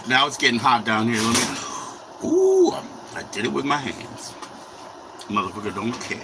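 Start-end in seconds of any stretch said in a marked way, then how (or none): 2.3-2.7 sudden high loud yelp of pain; real yelp of a burn; sharp ooh. Real, not acted. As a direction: jump as the hot grease bites. Burned while frying bacon.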